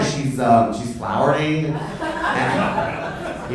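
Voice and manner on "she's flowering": ordinary talk, nothing marked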